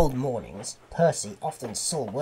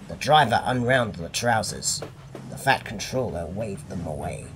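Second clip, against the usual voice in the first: ordinary delivery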